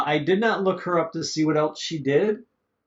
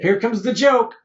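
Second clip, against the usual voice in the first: mock eager anticipation; 5 semitones higher, 6 dB louder than usual. Acts out hopeful anticipation while watching.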